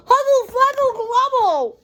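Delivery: put-on very high toddler gibberish; babbling toddler. Reading aloud to kids, voicing toddler Trixie, high babble. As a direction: babble it like a two-year-old who can't talk yet. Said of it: "'Cause remember, Trixie can't talk yet".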